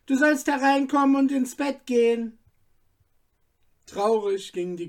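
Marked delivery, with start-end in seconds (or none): 0.1-2.4 mother calling. Acted.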